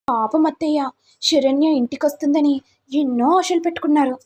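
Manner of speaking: gloating schemer. Acted. Sister-in-law taunts mother-in-law over her victory, mock sweet.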